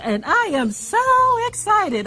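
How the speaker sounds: energetic answering machine greeting. Retold or imitated. Sister's outgoing message for callers, energetic imitation. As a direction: imitate an over-excited recorded greeting. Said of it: "energetic, uh, answering machine voice message".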